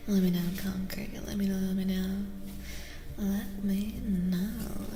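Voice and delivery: breathy intimate stream whisper; ASMR whisper. Real, not acted. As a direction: whisper gently to the viewers as if right beside them.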